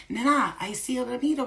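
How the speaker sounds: a Spanish abuela speaking to her granddaughter; Spanish-speaking grandma. Retold or imitated. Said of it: "she speaks Spanish mostly".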